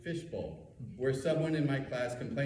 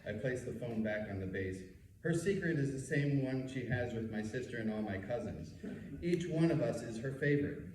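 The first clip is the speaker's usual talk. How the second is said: ordinary talk, nothing marked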